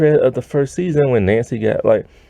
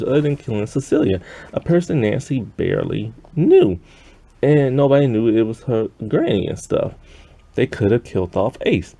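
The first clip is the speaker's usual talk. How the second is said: usual speaking voice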